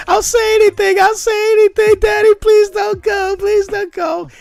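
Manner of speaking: put-on high, loud falsetto little girl begging; pleading little girl. Retelling the mute girl begging her father to stay. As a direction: cry out like a small girl begging her daddy. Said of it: "It's like".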